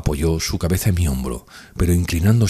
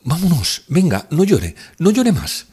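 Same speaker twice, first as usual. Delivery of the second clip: put-on higher, urgent young woman's cry; pleading exclamation, pitched up; exclaiming Nastenka; 10 semitones higher, 3 dB louder, slower than usual. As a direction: rush to stop him crying. Nastenka comforts him.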